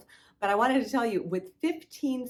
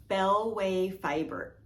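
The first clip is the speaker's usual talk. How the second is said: ordinary talk, nothing marked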